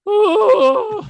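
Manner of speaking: very high, loud shocked "Oh"; high exclamation. Retold or imitated. Dropping the milk as a kid, a loud high yelp. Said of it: "and I went".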